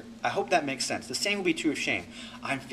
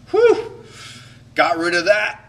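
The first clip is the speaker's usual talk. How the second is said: mock relief; 3 semitones higher, 9 dB louder, slower than usual. Shown as a demonstration. Mocking the relief of venting anger, loud whew. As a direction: blow it all out, then satisfied. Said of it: "I expressed my anger".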